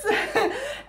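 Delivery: a bright, high burst of laughter; high delighted laugh. Real, not acted. Laughs at the coincidence.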